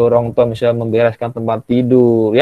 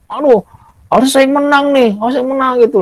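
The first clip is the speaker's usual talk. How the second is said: high, whiny mock tantrum; sore loser child; 13 semitones higher than usual. Teacher imitating a pupil sulking after losing, high whine. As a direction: play a kid who must win. Terus kalah.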